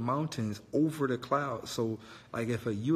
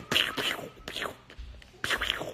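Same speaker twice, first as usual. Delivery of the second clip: breathy pew pew blaster sounds; whispered laser zaps. Shown as a demonstration. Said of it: "gotta go pew".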